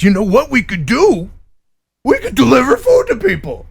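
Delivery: naive excited idea. Retold or imitated. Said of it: "everybody who found out about drones last week is".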